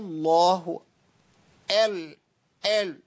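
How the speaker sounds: الله opened with "eh" instead of "ah"; imala on hamza. Shown as a demonstration. Teacher mimics a faulty reader, imala on the hamza of الله. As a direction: tilt the first vowel of الله toward E and hold it. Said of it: "إمالة للهمزة".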